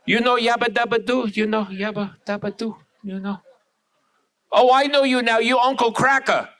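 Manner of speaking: imitated fan in broken-English Hong Kong accent; mock Hong Kong accent. Hong Kong woman guessing who's famous, mock accent. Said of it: "She goes".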